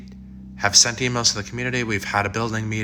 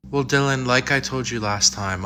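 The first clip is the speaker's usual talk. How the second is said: normal talking voice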